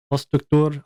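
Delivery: "Struktur" in a put-on foreign accent, ending like "toor"; weird accent. Shown as a demonstration. Streamer settling on a title's pronunciation, weird accent on "Struktur". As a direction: give "Struktur" a weird accent with a long final oo. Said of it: "I'll say it with that weird accent".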